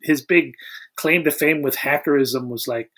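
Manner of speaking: ordinary talk, nothing marked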